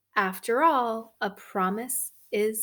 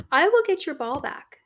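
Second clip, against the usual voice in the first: loud, quick and eager; a keen little frog, loud and fast; 6 semitones higher, 8 dB louder, faster than usual. Acted. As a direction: rush the offer out brightly.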